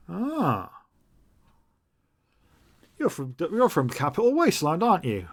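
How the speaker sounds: a hint of a stranger sizing someone up; Zed greeting Jade. Zed asking Jade where she comes from, lightly curious. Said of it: "He looks at Jade and says".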